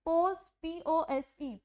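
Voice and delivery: पोज, then पी ओ एस ई spelled slowly. Shown as a demonstration. Teacher spells पोज slowly for students. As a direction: spell पी ओ एस ई out letter by letter, slow. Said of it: "ज की sound दे रहा है".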